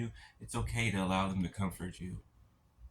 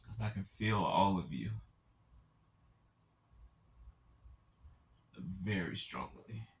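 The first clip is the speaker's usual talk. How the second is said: near tears. Real, not acted. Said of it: "I'm trying not to cry".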